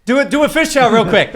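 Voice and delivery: usual speaking voice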